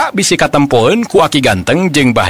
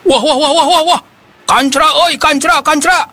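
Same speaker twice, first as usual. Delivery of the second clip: high, excited yelling; loud excited shout; 10 semitones higher than usual. Acted. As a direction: shout it out, thrilled with the catch. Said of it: "wakuwu ngagorowok tarik".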